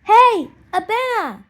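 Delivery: loud, slow high call across the beach; children calling to a friend far off. Acted. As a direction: shout her name to catch her attention. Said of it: "They call out to her".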